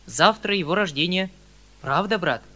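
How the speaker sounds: usual speaking voice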